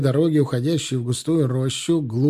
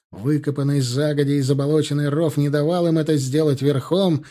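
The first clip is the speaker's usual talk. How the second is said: usual speaking voice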